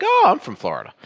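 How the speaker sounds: retold pleased surprise, pitched high; mildly acted "oh, me too" discovery. Retelling his eager bar reply.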